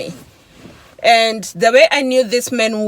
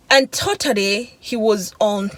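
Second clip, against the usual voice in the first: normal talking voice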